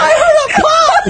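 high shocked laughter; laughing in shock. Real, not acted. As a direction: laugh it off, stunned. Just got slapped hard.

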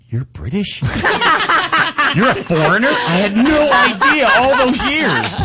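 rising, laughing mock astonishment; hammed-up shocked fan; shocked disbelief. The host recalls learning the singer is British, laughing surprise. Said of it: "And I went".

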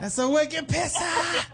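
high-pitched, hammed-up Boston accent; laughing, exaggerated Boston accent. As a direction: crow it in broad mock Bostonian. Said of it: "the accent, the, uh, the whole thing".

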